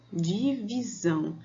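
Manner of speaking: "Divisão" slowly measured out in syllables; slow, teacherly "Divisão", part by part. Shown as a demonstration. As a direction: stretch each syllable of "Divisão". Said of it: "a divisão das palavras em sílabas".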